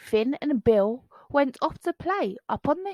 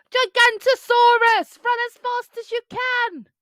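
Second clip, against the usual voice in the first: high, loud alarm cry; 13 semitones higher, 7 dB louder than usual. A narrator's reading. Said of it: "the cry began".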